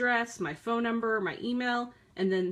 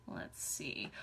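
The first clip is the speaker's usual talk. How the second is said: whispered to herself; 7 semitones lower, 14 dB quieter than usual. Real, not acted. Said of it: "searching through messages".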